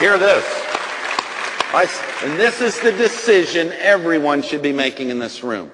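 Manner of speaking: loud commanding call; preaching at full force. Real, not acted. Preacher commanding the congregation, loud. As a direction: call the whole room to attention.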